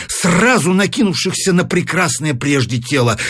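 ordinary delivery, nothing marked

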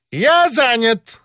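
high, slow peacock cry of an official; haughty, high-pitched self-important squawk. A narrator's reading. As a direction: draw it out high like a vain peacock. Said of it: "сказал он павлиньим голосом".

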